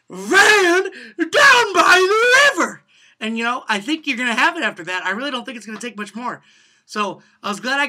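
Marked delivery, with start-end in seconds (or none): 0.1-2.8 high, very slow, loud cracking "Van down by the river"; full wailing yell. Shown as a demonstration. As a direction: drag out every word at the top of the voice. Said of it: "Your hand gesture".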